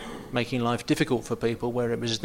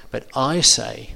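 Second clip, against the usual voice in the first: but I say, slow and louder; emphatic I; 6 dB louder, slower than usual. Shown as a demonstration. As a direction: plant your weight on I. Jesus's emphatic contrast demo.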